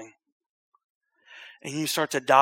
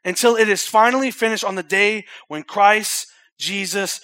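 higher, louder preached reading; preaching scripture; 5 semitones higher, 6 dB louder than usual. Real, not acted. Pastor preaching Philippians to the church, loud and high. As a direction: proclaim it with force.

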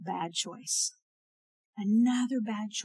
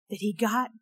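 ordinary delivery

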